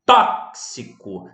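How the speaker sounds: tóxico drawn out, TÓ punched loud; slow down tóxico and hit the first syllable, TÓ; stress on antepenultimate syllable. Shown as a demonstration. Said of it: "sílaba tônica na antepenúltima sílaba". (tóxico silabado, com força no TÓ)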